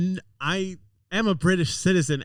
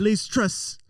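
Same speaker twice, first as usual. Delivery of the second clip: put-on British accent; 9 semitones higher than usual. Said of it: "I can do it".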